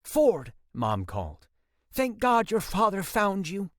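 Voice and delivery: a relieved mom rushing to the hall; worried mother. A narrator's reading. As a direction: call out to her son in relief.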